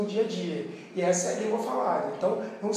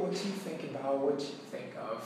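quieter, lower, tossed aside; teacher downplaying the less common phrasing; softer, throwaway; 5 semitones lower, 8 dB quieter than usual. Shown as a demonstration. Muttered lower aside. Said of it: "muito mais utilizada do que o".

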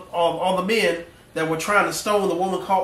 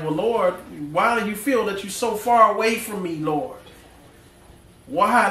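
higher, louder pleading to the Lord; pleading prayer; 4 semitones higher, 5 dB louder than usual. Retold or imitated. Believer complaining to God that prayers go unanswered, pleading. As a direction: beg the Lord, building frustration. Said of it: "you be like".

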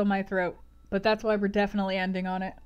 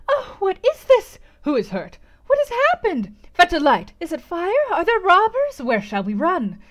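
high, loud panicked questions; confused alarm; 9 semitones higher, 8 dB louder than usual. Acted. Roused guests in the dark gallery, confused panicky cries. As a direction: fire off questions like a startled crowd. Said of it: "was demanded confusedly on all hands".

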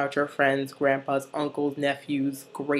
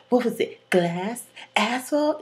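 Santana; 6 semitones higher, slower than usual. Acted. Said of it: "And she's like".

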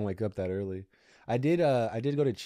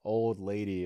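normal talking voice

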